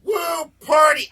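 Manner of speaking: high loud whoop that breaks into pain; mock party cheer. Real, not acted. Host faking enthusiasm with a sore back, loud whoop. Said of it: "You're really enthusiastic about this today".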